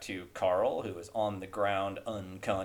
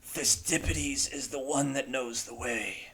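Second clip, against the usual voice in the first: dying man's voice; 4 semitones higher than usual. Acted. Dying character's last words.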